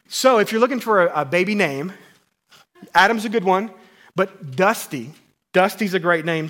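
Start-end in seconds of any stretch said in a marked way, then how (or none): none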